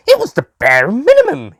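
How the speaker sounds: high, loud whiny complaint; complaining. Acted. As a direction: grumble it like a sulky child. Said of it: "he complained".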